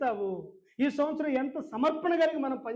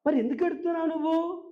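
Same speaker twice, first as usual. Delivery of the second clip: higher-pitched, rising exasperation as he presses her; retold pestering grandson, pitched up; insistent questioning; 6 semitones higher than usual. Grandson pressing his crying grandmother for a last wish, insistent.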